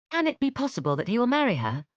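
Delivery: high, fast disbelieving question; incredulous. Acted. Elizabeth doubting Wickham will marry, quick incredulity. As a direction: rush out the doubt.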